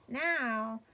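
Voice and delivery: ordinary talk, nothing marked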